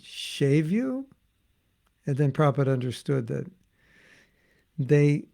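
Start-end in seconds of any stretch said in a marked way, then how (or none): 0.0-1.1 high, rising question; puzzled devotee. Retold or imitated. Storyteller voicing a devotee hearing "shave", puzzled. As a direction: query it in surprise, pitch climbing. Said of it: "And the devotee said".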